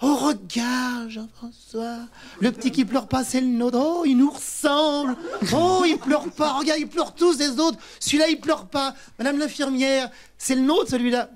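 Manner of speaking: gushing new father. Retold or imitated.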